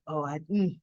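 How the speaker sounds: low, soft scheming murmur; hushed plotting, eyes on her prize; mock Diane plotting under her breath; scheming inner voice. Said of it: "gazing at Jack like".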